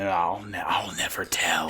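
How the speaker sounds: secretive whisper. Retold or imitated. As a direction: breathe it out secretively. Masked man hiding identity.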